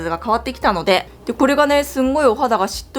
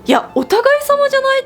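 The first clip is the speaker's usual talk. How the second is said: indignant retort; 3 dB louder than usual. Retold or imitated. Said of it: "って思って".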